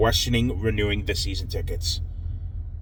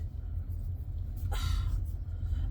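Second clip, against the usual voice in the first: weary sigh; 12 dB quieter than usual. Real, not acted. After complaining about GMs.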